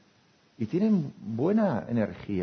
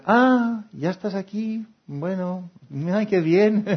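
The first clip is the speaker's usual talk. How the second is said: much higher, louder, fake-cheerful sigh; mock disappointed welcome; 10 semitones higher, 7 dB louder, much slower than usual. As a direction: greet them with forced cheer. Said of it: "Y cuando llegas". (bienvenida fingida, mucho más aguda, sin ganas)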